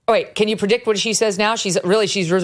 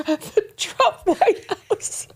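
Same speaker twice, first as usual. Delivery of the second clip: laughing; 12 semitones higher, much slower than usual. Real, not acted. Laughing at her gaffe.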